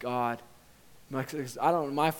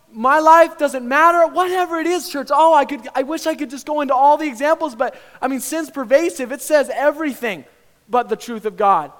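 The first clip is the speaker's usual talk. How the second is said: loud, high, impassioned sermon shout; full-force preaching; 11 semitones higher, 14 dB louder than usual. Acted. Preacher urges church to look at the Father, shouting. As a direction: drive each phrase home at full volume.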